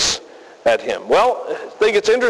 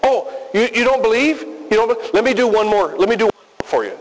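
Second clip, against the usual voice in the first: higher, hurried offer of one more miracle; mock eager Jesus; 5 semitones higher than usual. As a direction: rush to win them back with another trick. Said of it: "he also doesn't offer to show them more proof".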